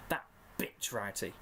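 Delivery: under-breath whispered curse; muttered. A narrator's reading. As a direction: hiss the curse quietly.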